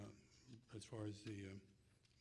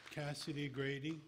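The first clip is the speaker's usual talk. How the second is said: calling out names. Real, not acted. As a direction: announce each name to the hall.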